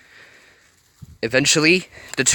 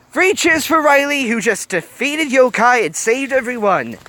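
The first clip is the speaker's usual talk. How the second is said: cheering; 13 semitones higher, 6 dB louder than usual. Real, not acted. Reader leads cheer for Riley.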